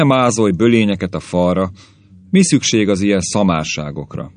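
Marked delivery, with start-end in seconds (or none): none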